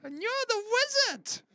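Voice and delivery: very high screechy bird; evil raven voice. Pretend. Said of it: "evil raven voice".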